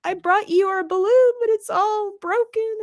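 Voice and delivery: very high-pitched little voice; plaintive, halting confession; mock sorrowful. Piglet explains the broken balloon to Pooh, mock sorrowful.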